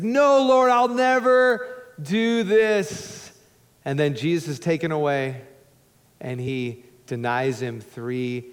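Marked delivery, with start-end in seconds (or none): 0.0-3.3 high, loud protest, slowing on never; Peter protesting. Retold or imitated. Peter vowing loyalty to Jesus, high loud protest. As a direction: cry out the vow. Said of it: "And he's like".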